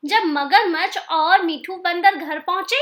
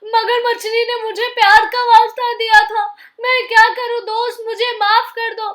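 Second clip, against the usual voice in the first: higher, begging for forgiveness; a mildly raised plea, rising to the last words; pleading; 5 semitones higher, 5 dB louder than usual. Acted. Crocodile begging the monkey to forgive him, pleading.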